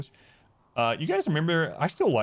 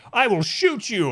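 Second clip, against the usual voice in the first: loud-edged, high Shakespearean threat; hammed-up movie duelist, pitch jumping up; theatrical threat; 9 semitones higher, 4 dB louder than usual. Acting out film gunman's line.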